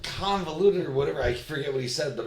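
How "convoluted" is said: annoyed engineer. Retold or imitated. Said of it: "O'Brien was all pissed off".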